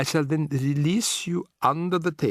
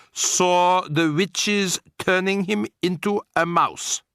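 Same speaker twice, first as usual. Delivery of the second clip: stately grandmother; 8 semitones higher, 5 dB louder, much slower than usual. A narrator's reading. Grandmother stating it firmly.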